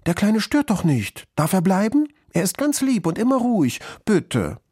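put-on high-pitched pleading child; quick plea. A narrator's reading. Schoolgirl pleading for her dog to stay, high and urgent. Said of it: "Muriel rief schnell".

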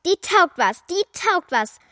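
insisting loudly; defiant. Acted. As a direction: fight back for her mother. Child defends mother in quarrel.

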